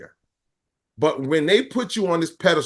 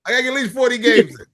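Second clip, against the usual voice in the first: high, loud, fast whiny plea; squeaky insistent old player; high mock voice; 10 semitones higher, 4 dB louder than usual. Voicing an older, pleading Amari.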